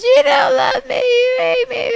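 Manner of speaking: very high, loud mock wail; acted tearful tantrum shriek; bawling little girl. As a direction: play a child bursting into tears. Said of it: "bursts into tears and says".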